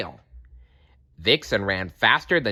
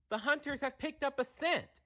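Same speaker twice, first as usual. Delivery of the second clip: high soft bird voice; 11 dB quieter than usual. A narrator's reading. Kestrel voice.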